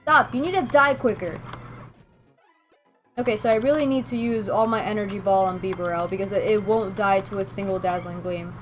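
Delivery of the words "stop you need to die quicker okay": usual speaking voice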